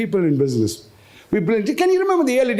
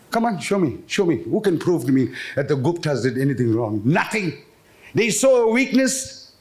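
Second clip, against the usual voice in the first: ordinary talk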